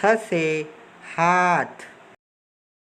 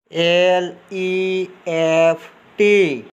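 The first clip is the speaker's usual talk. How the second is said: slow, letter-by-letter spelling; 3 semitones higher, 4 dB louder, much slower than usual. Shown as a demonstration. Teacher spells an English word for learners, slow spelling. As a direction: spell it slowly, each letter held.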